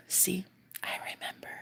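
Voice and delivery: softly whispered reveal. Retold or imitated. Partner's smug whispered reply, retold as a whisper. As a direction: murmur it knowingly. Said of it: "he goes".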